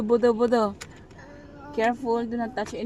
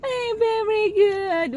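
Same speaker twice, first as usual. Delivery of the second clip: excited praise; 9 semitones higher, 6 dB louder, slower than usual. Real, not acted. Mother cheers her toddler for saying Dada, high and slow.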